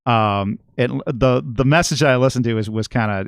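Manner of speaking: ordinary talk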